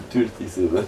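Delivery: Thirty-seven as "tulti-sevè", heavy French accent; hammed-up French accent on Thirty-seven; French accent in English. Shown as a demonstration. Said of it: "je me fais rire avec un accent, là, mon accent à la con".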